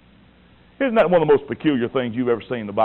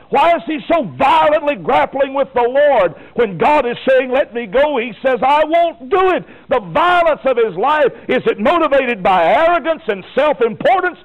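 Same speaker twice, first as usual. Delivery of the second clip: fiery preaching; 10 semitones higher than usual. Acted. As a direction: pound the point home from the pulpit. Preaching at full force.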